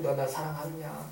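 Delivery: low, soft, slow question; gentle. Acted. Jesus asking Peter if he loves him, gentle. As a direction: ask with quiet tenderness.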